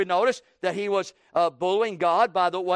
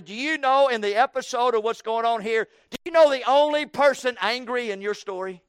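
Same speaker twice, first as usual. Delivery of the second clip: higher, louder fervent appeal; impassioned preaching; 6 semitones higher, 4 dB louder than usual. Real, not acted. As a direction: call out to Jonah with fire. Preacher calling out to Jonah.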